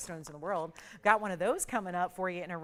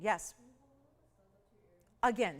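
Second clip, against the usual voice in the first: ordinary talk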